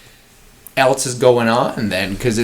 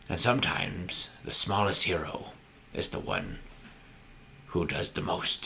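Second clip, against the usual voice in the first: eloquent narrator; 2 semitones lower, 11 dB quieter than usual. Pretend. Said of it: "just this eloquent, like, Liam Neeson".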